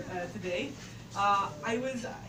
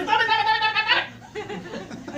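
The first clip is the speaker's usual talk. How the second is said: loud high laughing curses, slowing; retold cursing; 11 semitones higher, 10 dB louder than usual. Girl with her foot run over, cursing loudly and high. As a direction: swear through the pain, laughing.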